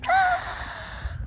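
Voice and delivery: extremely high, loud short shriek; piercing scream. A narrator's reading. He hears another scream outside, piercing shriek. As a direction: squeal out a sudden screech. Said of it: "you hear another scream".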